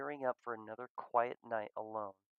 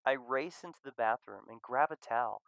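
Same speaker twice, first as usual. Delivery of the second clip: usual speaking voice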